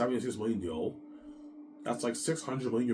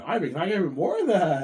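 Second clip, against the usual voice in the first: higher, louder greedy plea; greedy Peter; 9 semitones higher, 8 dB louder than usual. Retold or imitated. Peter pushing Ivan for more money, higher and louder. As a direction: beg for more, pitched up. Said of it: "He's salivating over the brand".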